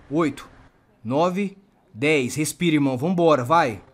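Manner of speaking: loud, driving encouragement on the count; urgent shout. Real, not acted. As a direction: push him to breathe, raising your voice. Urging a shot man to breathe.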